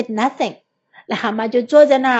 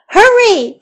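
urgent call; 7 semitones higher, 6 dB louder than usual. A narrator's reading. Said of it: "said Frog".

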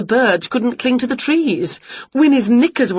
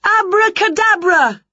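high shouted magic word; shouted spell; 12 semitones higher, 4 dB louder than usual. Acted. Winnie shouts a spell at herself, high and loud. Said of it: "she shouted".

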